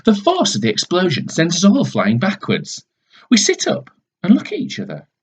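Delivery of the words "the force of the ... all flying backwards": ordinary delivery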